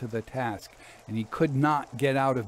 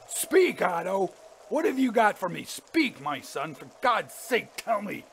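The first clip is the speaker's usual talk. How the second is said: put-on high, desperate old man's plea; vibrating, pleading urgency; 7 semitones higher than usual. A narrator's reading. Father begs Otto for the money, voice vibrating urgently. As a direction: beg urgently, jumping high on each demand. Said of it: "the old man's vibrating accents".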